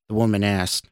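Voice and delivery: plain reading voice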